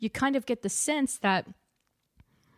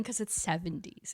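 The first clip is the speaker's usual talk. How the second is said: ordinary talk, nothing marked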